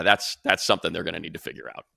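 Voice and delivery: ordinary talk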